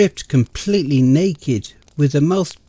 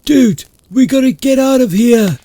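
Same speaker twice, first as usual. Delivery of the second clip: panicked urging; 11 semitones higher, 6 dB louder than usual. Acted. Panicked friend wants to flee.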